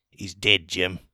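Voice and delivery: deadpan line reading, low and plain; flat acting. Student actor reading his line flat in film class. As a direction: read it like a wooden student actor. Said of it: "Just like that. You know, perfect acting".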